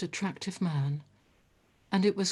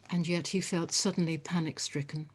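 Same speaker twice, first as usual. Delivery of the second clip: ordinary delivery, nothing marked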